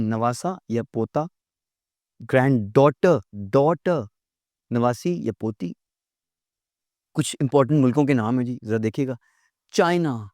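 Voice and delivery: normal talking voice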